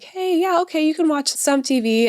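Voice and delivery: high, sing-song, overly permissive; hammed-up pushover parent, way up high; passive parent. Mom mocks her passive self letting kids watch TV, high and sing-song. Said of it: "for me to be passive and be like".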